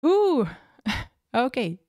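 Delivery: ordinary talk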